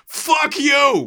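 high, loud mock swearing shout; imitated street yell. Recalling New Yorkers swearing at each other, imitated angry yell. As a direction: yell it like a passer-by across the road. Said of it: "people going".

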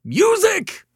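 MUSIC shrilled very high and loud. A narrator's reading. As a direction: shriek the word MUSIC in exasperation. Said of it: "shrilled Mister Morrison".

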